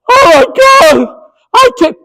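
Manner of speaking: high, strained, near-crying protest; upset coach. Retold or imitated. Coach crying out at the umpire's call, high and upset. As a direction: wail it like a coach who can't believe it.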